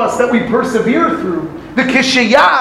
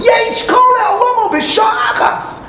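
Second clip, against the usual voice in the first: triumphant proclamation; 12 semitones higher than usual. Retold or imitated. Rebbe as usually read, triumphant proclamation. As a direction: announce it like a victory. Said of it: "How do we usually interpret".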